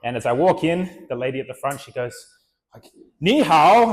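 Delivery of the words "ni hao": higher, much louder cheery hello; shopkeeper's bright, loud welcome; enthusiastic greeting. Retold or imitated. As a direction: greet him with big enthusiasm. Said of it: "like, very enthusiastic".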